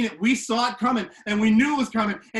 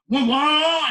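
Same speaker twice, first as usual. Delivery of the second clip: loud high mock engine rev; car engine noise; engine revving; 7 semitones higher, 8 dB louder than usual. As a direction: vroom like a car pulling in.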